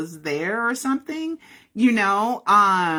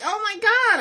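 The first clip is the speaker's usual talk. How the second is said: mock surprise. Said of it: "I'm a little confused. Like".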